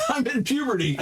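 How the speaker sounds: giggling through the words; laughing. Real, not acted. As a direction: laugh while saying it. Player repeats joke laughing.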